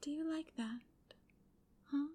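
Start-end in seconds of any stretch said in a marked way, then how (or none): none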